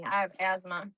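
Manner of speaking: ordinary talk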